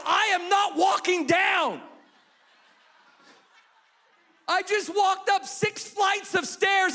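dad yelling he won't walk down; exasperated yell. Retold or imitated. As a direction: hammer each word at the kids. Dad yelling back at top.

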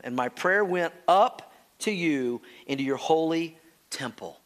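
normal talking voice

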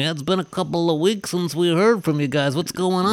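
listener voice. Retold or imitated. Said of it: "you're probably wondering".

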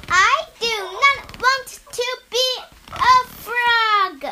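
high, loud alarmed protest. Acted. Gerald panicking he might become a frog, high and loud.

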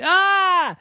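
very high, loud ah; shocked shriek at the spill; startled yelp. Acted. Daddy's food falls.

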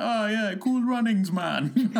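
high, playful mock Jamaican accent. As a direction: imitate a TV character's Jamaican accent, high and laughing. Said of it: "doing a Jamaican accent".

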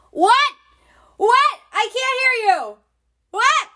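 hammed-up shouting of the loud plane passenger; imitating a yelling man. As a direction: shout like a man who can't hear himself through his headphones. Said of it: "was yelling".

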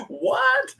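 squeaky high disbelieving what; shocked "what". Retold or imitated. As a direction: pitch it way up in disbelief. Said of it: "And you're like".

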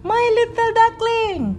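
high-pitched surprised Mother Duck. A narrator's reading. Mother Duck greets her little duckling at the pond, surprised. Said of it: "Mother Duck in surprise".

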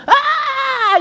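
put-on sudden high scream from a child's nightmare; boy's nightmare scream. A narrator's reading. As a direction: let out a high, loud scream in terror. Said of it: "he cried out in his sleep".

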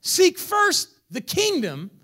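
slow, high, loud preaching build; preaching at full force. Acted. Preacher to congregation, rising to full force. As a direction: stretch and build each word. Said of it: "building to preaching peak".

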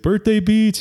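high, excited mock call-out; mock yell. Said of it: "someone yelled".

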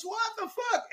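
very high, squeaky cartoon cry of disbelief; Randy Marsh again. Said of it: "and he goes".

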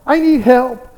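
loud, high pleading to God; fervent prayer. Acted. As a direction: cry out an earnest, acted prayer. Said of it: "she kept on praying".

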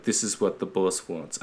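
Italian mobster slipping into Russian; botched Italian accent. Retold or imitated. Host voicing Italian cousins, accent coming out Russian. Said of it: "why I made him Russian".

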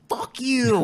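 high-pitched mock kid outburst; bratty nine-year-old kid. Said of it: "nine-year-old kid is like".

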